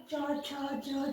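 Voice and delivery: च, छ, ज stretched very slowly; च, छ, ज बहुत धीरे; slow palatal demo. Shown as a demonstration. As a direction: spell out च, छ, ज one by one. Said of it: "सटता है ना ऊपर".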